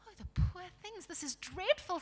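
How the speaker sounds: high, pitying exclamation; distressed girl. Acted. Lucy pitying the blinded dwarves, distressed.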